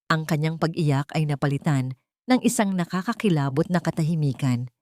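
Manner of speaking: usual speaking voice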